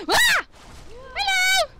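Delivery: high, loud gasp and yelp; startled gasp. Real, not acted. Gamer jumping at an enemy, high loud gasp. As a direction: jump in fright and call out.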